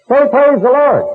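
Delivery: high, loud barking order; mock furious healer. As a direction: snap him silent like a tyrant preacher. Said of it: "healer commands crowd".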